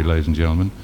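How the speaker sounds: ordinary talk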